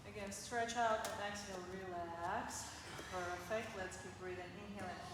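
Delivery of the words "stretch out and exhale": normal talking voice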